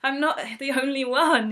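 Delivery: high, bright-toned relieved exclamation. Retold or imitated. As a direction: sound thrilled you're not alone. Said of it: "I was so relieved".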